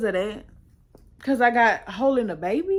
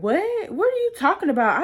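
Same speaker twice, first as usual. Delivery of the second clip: high, startled What? then a rising protest; jolted out of her seat by the word warrant; shocked disbelief; 8 semitones higher, 3 dB louder than usual. Retold or imitated. Speaker to her friend in the car, in shocked disbelief.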